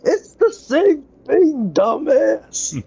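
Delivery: high-pitched, drawn-out exasperation; exasperated retort. Retold or imitated. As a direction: stretch it out high, fed up with a friend. Retelling own exasperated reply to Griffin.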